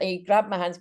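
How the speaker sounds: usual speaking voice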